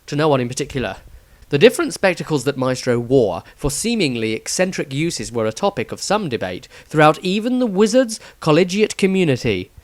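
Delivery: plain reading voice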